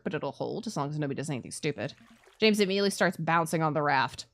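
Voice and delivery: normal talking voice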